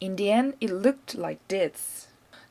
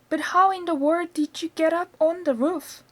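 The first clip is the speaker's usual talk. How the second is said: crying out; 8 semitones higher, 4 dB louder than usual. A narrator's reading. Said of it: "cried the mother".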